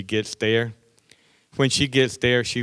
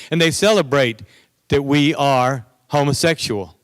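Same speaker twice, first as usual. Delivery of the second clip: preaching; 4 semitones higher, 3 dB louder than usual. Acted. Preaching against sin.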